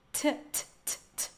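isolated voiceless "t" bursts; strong T sound. Shown as a demonstration. Teacher drilling the British consonant, sharp voiceless "t". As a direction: tap out a crisp, aspirated "t" on its own. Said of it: "esse T vai ter som de T bem forte".

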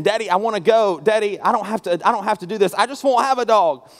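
high-pitched pleading, mildly imitating a screaming boy; retold child's screaming. Son begging his daddy to skip the shot, imitated protest. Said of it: "he starts screaming".

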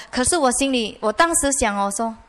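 normal talking voice

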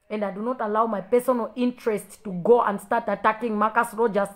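loud, emphatic preaching delivery; forceful preaching. Real, not acted. Defending a fellow preacher against attackers, forceful preaching.

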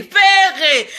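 loud, high drawn-out call; exasperated loud call. Real, not acted. Calling out the man by name.

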